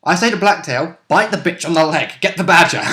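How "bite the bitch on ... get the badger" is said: ordinary talk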